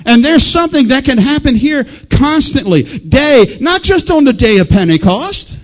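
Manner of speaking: high, building preacher's delivery ending in a shout; forceful preaching. Real, not acted. Preacher exhorting the congregation about prayer, impassioned.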